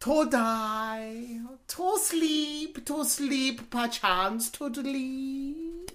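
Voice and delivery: slow, drawn-out put-on Chinese accent; mock Chinese accent. Actor reciting Hamlet for an audition in mock Chinese accent.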